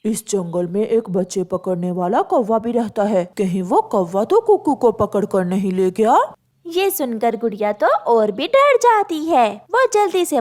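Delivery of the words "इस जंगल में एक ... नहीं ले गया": lower, hushed warning; worried pigeon. Acted. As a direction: warn of a child-snatcher. Pigeon warns of crow.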